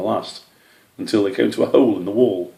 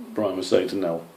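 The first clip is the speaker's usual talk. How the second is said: usual speaking voice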